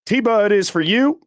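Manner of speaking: ordinary talk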